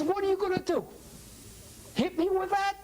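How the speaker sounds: high-pitched, grinning taunt; amused, derisive dare; mocking grin. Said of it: "he starts to grin".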